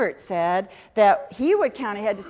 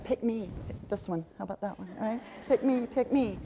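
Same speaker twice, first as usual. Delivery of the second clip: hushed, quick pleas to be chosen; eager pupil; 6 dB quieter than usual. Retold or imitated. Keen student with a hand up in class, hushed eager pleading. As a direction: play a keen kid begging under the breath.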